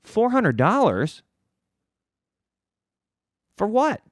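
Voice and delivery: stunned, slowed-down repeat of the price; mock disbelief. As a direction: act floored by the bill. Shocked reply to price.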